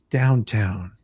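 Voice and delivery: ordinary delivery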